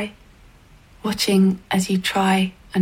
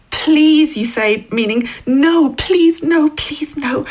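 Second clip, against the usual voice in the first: desperate pleading; 6 semitones higher, much slower than usual. A narrator's reading. Young writer refuses the man's escort, pleading. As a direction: beg him to leave you alone.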